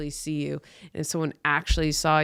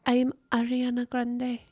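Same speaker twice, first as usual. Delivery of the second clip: higher, breathy-sounding celebrity impression; 6 semitones higher, 3 dB quieter than usual. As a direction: do her little pop-star voice, mocking. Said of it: "That's, like, how she talks now".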